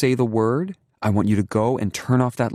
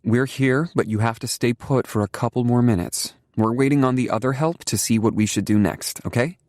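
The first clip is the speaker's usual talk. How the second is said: soothing; 7 semitones higher, 3 dB louder than usual. A narrator's reading. Mother calming child.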